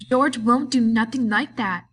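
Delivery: Lenny repeats it, frightened and stubborn; insistent repeat. A narrator's reading. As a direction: dig in and say it again. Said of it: "he repeated".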